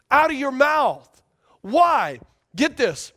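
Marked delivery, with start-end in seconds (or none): none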